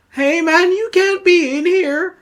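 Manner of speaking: high, loud dude calling down; legally distinct dude voice. Acted. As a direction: shout a warning as a dopey young dude. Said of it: "In my legally distinct voice".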